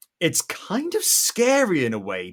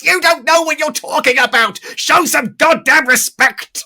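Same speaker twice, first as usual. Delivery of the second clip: much higher and louder outraged yelling; mock rage, much louder; furious shout at auctioneer; 13 semitones higher, 10 dB louder than usual. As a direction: scream at the clueless auctioneer. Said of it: "just strangle, like".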